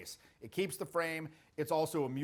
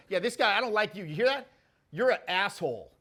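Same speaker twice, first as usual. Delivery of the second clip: higher, louder mock jeer; retold insult; 4 semitones higher, 5 dB louder than usual. Girl insults him in front of his clients, loud and taunting.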